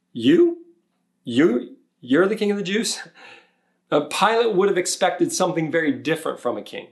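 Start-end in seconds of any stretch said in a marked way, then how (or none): 0.1-3.0 mock disbelief, repeated "you" pitched up high; incredulous you. Pilate doubting the lowly Jesus, incredulous "you". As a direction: scoff at "you", as if he can't believe it. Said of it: "It's almost like Pilate is saying".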